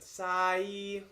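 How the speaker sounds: hard Cockney vowel on a held note; super Cockney accent. Shown as a demonstration. Demonstrating a disliked way to sing it, in broad Cockney. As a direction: lean heavily into Cockney. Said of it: "super Cockney accent".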